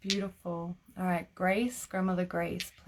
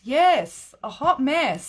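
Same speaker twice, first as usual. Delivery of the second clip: much louder and higher, gleeful; excited agreement; 7 semitones higher, 11 dB louder, slower than usual. Real, not acted. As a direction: belt it back in delighted agreement. Loudly agreeing with the comment.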